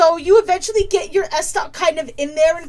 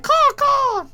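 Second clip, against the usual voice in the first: shrill high cawing bird; 12 semitones higher than usual. Acted. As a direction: screech it like a big bird. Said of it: "Demetrius kind of come up to you and be like".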